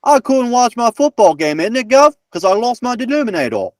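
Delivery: high-pitched, hammed-up British accent; mock British accent. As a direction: play an exaggerated Brit using the word in a sentence. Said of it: "It's like Sir Richard Attenborough is with us".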